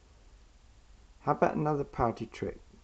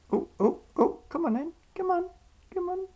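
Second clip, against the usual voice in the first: squeaky praising a dog. Real, not acted. Coaxing pet bird.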